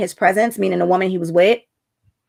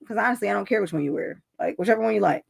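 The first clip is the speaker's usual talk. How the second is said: offhand muttered reply; 7 dB quieter, faster than usual. Retold or imitated. Brushing husband off.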